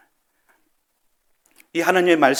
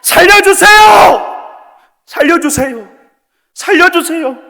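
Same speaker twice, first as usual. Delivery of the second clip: much higher, louder, slow screaming cry for help; desperate shout; 12 semitones higher, 9 dB louder, much slower than usual. Shown as a demonstration. Preacher shows how the dying cry to God: desperate full-voice shout. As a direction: scream for your life with all your strength. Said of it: "내 있는 힘껏".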